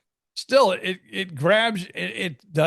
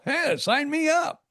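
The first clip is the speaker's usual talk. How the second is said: much higher, slow eager quip; 10 semitones higher, slower than usual. Retold or imitated. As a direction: jump at the chance, half joking. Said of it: "And I go".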